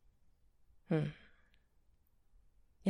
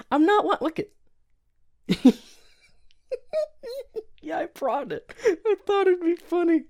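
higher, louder, giggling through words; laughing; 9 dB louder than usual. Acted. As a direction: laugh at your own joke. Giggling confession.

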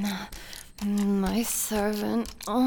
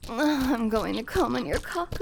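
usual speaking voice

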